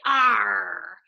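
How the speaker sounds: loud growled Arr; pirate arr. Retold or imitated. Teacher showing the class how pirates say it, with a pirate growl. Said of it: "They say".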